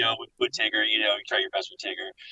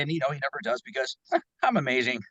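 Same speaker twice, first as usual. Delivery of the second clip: Sonic voice; 5 semitones higher, 3 dB quieter, faster than usual. Acted. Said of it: "maybe you can do a Sonic".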